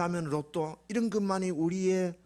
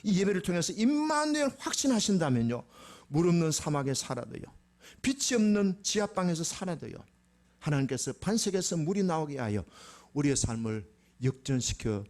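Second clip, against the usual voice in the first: normal talking voice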